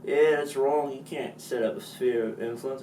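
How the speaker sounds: loud, high mock British scolding; hammed-up British accent telling off. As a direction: shout it like a cartoon Brit. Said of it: "The Brits went".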